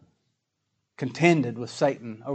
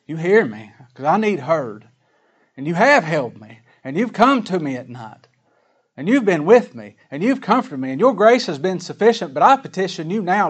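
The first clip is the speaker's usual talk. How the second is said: raised, loud, pleading prayer; fervent prayer; 3 semitones higher, 6 dB louder than usual. Acted. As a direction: petition the Lord with force, phrase by phrase. Prays aloud in David's words.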